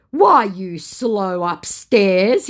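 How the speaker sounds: loud, very slow and high; mocked scammer. Scammer insults her in the chat, mocking.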